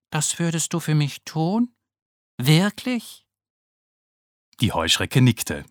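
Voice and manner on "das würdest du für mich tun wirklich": higher, timid little ladybird voice; a worried small beetle asking for help; small ladybird Naoki. Acted. Naoki anxiously asking Frau Heuschrecke about his spots, timid and high.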